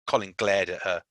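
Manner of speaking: usual speaking voice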